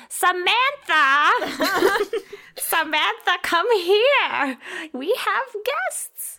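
high, loud call over the shoulder with a laugh. Acted. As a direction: shout for Samantha across the shop. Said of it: "she's like".

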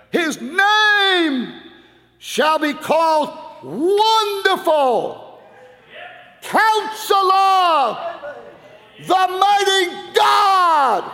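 His name, Wonderful, Counselor shouted high and slow; emphatic proclamation. Shown as a demonstration. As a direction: stretch out every title, loud and high. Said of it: "See the emphasis?".

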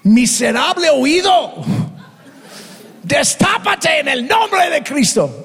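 high, shouted rebuke at the ear; angry command. Retold or imitated. Pastor angrily commanding a deaf ear to open. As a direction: yell the command in frustration.

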